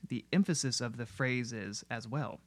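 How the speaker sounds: normal talking voice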